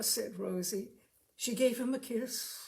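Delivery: plain reading voice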